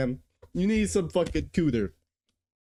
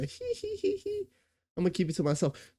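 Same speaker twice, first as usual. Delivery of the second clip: mock giggle; 12 semitones higher, 5 dB quieter, faster than usual. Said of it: "a cute little niche that you were".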